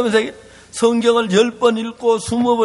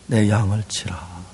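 gentle charge; 12 semitones lower, 4 dB quieter than usual. Acted. Jesus gives charge to Peter.

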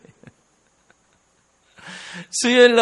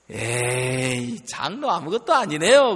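taquinerie rieuse; 7 semitones lower, 4 dB quieter than usual. Retold or imitated. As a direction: tease the elder, stretching the first word. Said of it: "제가 웃으면서 이렇게 이야기했어요".

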